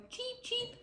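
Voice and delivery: tiny, very high peeps; soft high-pitched cheeping; duckling cheep. A narrator's reading. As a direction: peep softly like a baby bird. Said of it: "opened her tiny beak to cry".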